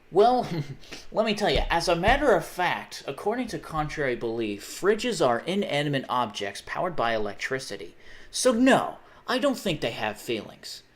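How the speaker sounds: emphatic, punched-up pretend expert answer; mock know-it-all.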